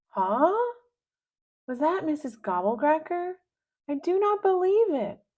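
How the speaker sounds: can't believe her ears; surprised disbelief. Acted.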